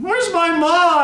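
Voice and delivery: put-on high, loud childish wail; blubbering. A narrator's reading. Scared child breaks down wanting mother, blubbering. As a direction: wail it like a lost, sniffling child. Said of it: "I blubbered".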